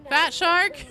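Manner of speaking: loud, high-pitched begging; hammed-up wheedling question; playful pleading. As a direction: call out a hopeful plea. Begging the developer for a class.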